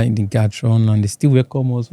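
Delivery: normal talking voice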